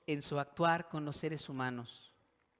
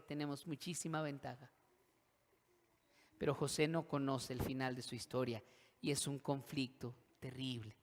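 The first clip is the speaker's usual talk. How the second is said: ordinary talk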